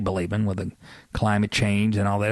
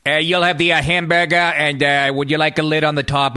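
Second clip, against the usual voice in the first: loud, higher, hammed-up Kennedy accent; bad JFK impression; 5 semitones higher, 5 dB louder than usual. McDonald's worker in a movie, as a bad JFK impression. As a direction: overdo a JFK voice taking a fast-food order. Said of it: "like, a bad J F K impression".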